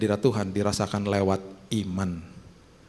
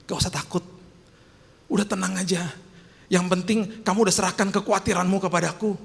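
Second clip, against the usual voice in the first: gentle reassurance; 9 semitones higher than usual. Acted. God's comforting words voiced.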